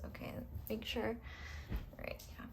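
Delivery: soft, whispered check to herself; whispered aside. Real, not acted. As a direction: whisper it to yourself while checking. Muttered aside while checking cards.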